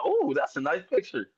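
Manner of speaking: lightly voiced pleased star; pleased wrestler voice. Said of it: "He was out there vanity searching".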